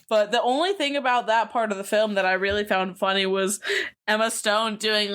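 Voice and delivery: ordinary talk, nothing marked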